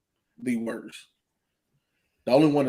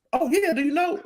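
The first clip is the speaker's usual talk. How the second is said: much higher, excited fan voice; mock eager fan. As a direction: squeal it like a starstruck fan.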